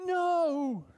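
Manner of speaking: high, loud cry of no; dad cries out for his daughter; protective shout. Retold or imitated. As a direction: shout to stop the hurt. Father wants to protect daughter.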